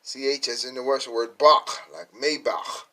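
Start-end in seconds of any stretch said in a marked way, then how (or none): 1.4-2.8 "bach" and "Maybach" ending on a rasped H; throaty CH closing "bach" and "Maybach"; rasping H. Shown as a demonstration. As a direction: rasp the final CH in "bach" and "Maybach". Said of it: "it got like a rasping H sound".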